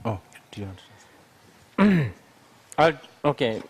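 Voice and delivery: ordinary delivery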